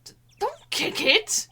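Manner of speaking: loud, high, slow cry; alarmed shout. Real, not acted. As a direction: shout to stop the kick. Friend kicking the camera.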